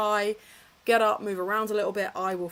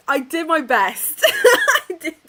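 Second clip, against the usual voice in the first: laughing; 8 semitones higher, 11 dB louder than usual. Real, not acted. Laughing about her French attempt.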